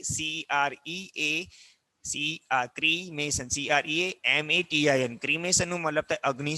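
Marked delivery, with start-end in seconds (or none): 0.0-5.2 ક્રિમેશન spelled slowly letter by letter; spelling it out. Shown as a demonstration. Teacher spelling ક્રિમેશન slowly for students. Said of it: "ક્રિમેશન પણ કહીએ".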